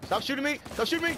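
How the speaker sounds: high-pitched pleading protest; urgent protest. Real, not acted. Gamer under fire to whoever is shooting, urgent protest.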